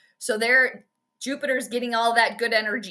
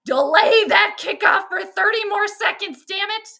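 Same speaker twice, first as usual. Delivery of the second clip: higher, louder mock demand; mock urgent order; 7 semitones higher, 4 dB louder than usual. Imagined Vikings astrologer demanding a kickoff delay, mock urgent. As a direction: play at ordering a delay, raised voice. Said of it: "I would have been like".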